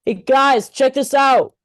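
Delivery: calling out loud and high to friends; yelling. Acted. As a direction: shout it across to the guys. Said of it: "why is he yelling".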